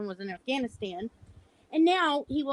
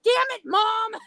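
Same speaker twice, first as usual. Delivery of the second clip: loud exasperated yell, high; retold son's yell; 10 semitones higher, 9 dB louder, slower than usual. Son caught out by his blind mom, yelling loud and high. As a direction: yell like a busted teenage son. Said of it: "he says".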